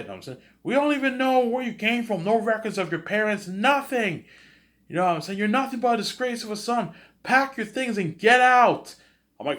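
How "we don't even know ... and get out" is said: very high, loud mocking parent voice; shrill scolding parent. Parents disowning adopted son, high shrill scolding. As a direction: scold the son like an outraged mother.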